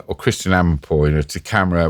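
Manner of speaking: normal talking voice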